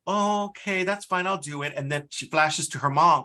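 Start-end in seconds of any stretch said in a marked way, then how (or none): 0.1-1.7 lightly acted higher-pitched millennial giving in; imitated millennial. Millennial agreeing to stay late for the boss, lightly acted reluctant consent. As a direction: mimic a pushover agreeing, pitched up. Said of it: "it shows the millennial saying".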